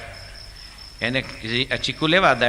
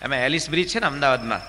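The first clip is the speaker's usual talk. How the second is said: usual speaking voice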